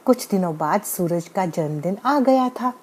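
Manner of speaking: ordinary delivery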